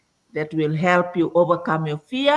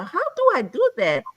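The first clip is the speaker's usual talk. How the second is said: higher-pitched retold bewilderment; mock helpless question, pitched up; puzzled wondering; 9 semitones higher than usual. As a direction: replay your baffled beginner self. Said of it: "I used to wonder".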